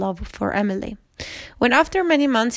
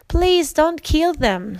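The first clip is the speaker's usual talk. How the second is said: higher, louder, slow plea; crying out; 6 semitones higher, 4 dB louder, slower than usual. A narrator's reading. The women plead with the Duke for the cousins, crying out. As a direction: beg him to spare them, slowly. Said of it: "Emily and Hippolyta cried".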